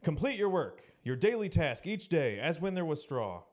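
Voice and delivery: sharp overseer demand; urgent command. Acted. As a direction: push them hard to finish. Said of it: "The taskmasters were urgent".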